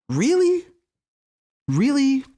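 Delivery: sharply high, rising disbelief; a pointed, drawn-up challenge of disbelief; incredulous. Real, not acted. Challenging the congresswoman's claim, incredulous.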